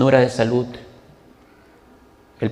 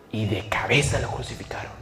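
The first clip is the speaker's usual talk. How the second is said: whispered, much softer solemn close; hushed whisper; 4 semitones lower, 7 dB quieter than usual. Real, not acted. Preacher ending Peter's crucifixion story to the congregation, whispered. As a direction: drop to a reverent whisper.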